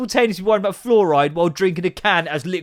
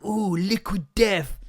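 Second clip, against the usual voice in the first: slow, mocking "Ooh, Liquid Death"; a drawn-out mocking coo; mock impressed; 5 semitones higher, slower than usual. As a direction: sneer at the trendy can. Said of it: "and it's all like".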